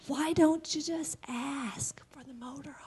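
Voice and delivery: soft whisper of God's question. Acted. God asking her in the dream, whispered. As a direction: breathe it as a gentle secret.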